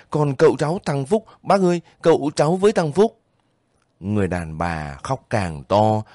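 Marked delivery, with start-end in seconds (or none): 0.1-3.2 higher, fast, words tumbling in fear; faltering voice. A narrator's reading. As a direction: stammer out the names in dread. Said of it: "giọng anh díu lại". (giọng díu lại, hốt hoảng)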